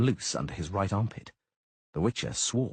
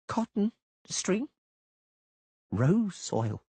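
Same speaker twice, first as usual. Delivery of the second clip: slow enunciation; 11 semitones higher, much slower than usual. Acted. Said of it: "enunciating each word very slowly".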